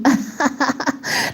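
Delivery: short real laugh. Real, not acted. Reacting to chat comment.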